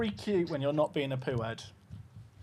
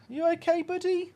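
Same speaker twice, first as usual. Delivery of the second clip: pet talk; 9 semitones higher, 4 dB quieter than usual. Real, not acted. Checking on the puppy.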